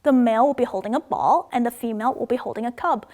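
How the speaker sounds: normal talking voice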